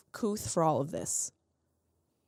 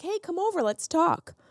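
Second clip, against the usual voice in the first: friendly call; 8 semitones higher than usual. Retold or imitated. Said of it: "is like".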